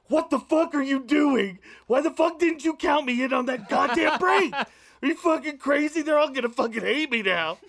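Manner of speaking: high, loud furious rant under the breath; frantic shrieking tirade, half laughing by the end; freaking out like a psycho. Retold or imitated. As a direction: lose it at the director, turned away from the set. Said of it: "freak out like a psycho".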